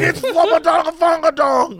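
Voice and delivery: high excited call. Retold or imitated. Coworker naming another streamer, high excited call. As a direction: announce it thrilled.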